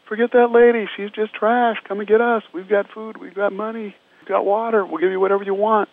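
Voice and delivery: high-pitched retold yelling from a rooftop; mock yell. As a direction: call down sharply like men on a roof. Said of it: "start yelling down".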